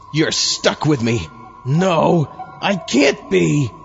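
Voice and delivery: normal talking voice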